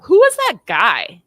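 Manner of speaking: high, loud, drawn-out mimicked question; insistent demand. Woman imitating her ex grilling her about a man, insistent. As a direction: mimic an accuser pressing for an answer. Repeats ex's sudden interrogation.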